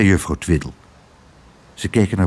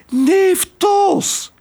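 very high, stretched cry of recognition; excited cry. Acted. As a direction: call out to a long-lost nephew. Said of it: "riep ze".

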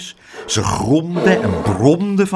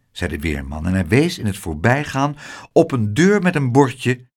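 ordinary delivery

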